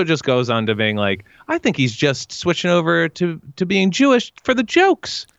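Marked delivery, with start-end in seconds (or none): 1.5-5.3 high, rising incredulous impression; high-pitched sitcom complaint; Jerry. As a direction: climb higher toward the punch word. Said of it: "pretty good impression of Jerry, uh, the way he said that".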